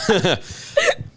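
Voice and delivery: laugh, rising in pitch. Real, not acted. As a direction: giggle that climbs up. Laughing at own joke.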